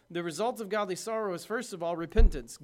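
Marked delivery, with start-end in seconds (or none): 2.0-2.6 proclaimed loud. Real, not acted. Key word proclaimed.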